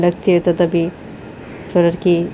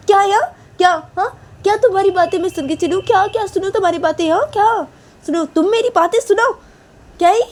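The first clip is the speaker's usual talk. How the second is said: much higher, fast whining retort; whiny quarrel; 13 semitones higher than usual. Acted. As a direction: whine back, refusing to listen. Sneha quarrels back whining.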